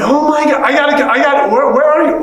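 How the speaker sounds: stumbling over words in eager disbelief; excited. Retold or imitated. Learning he has a brother.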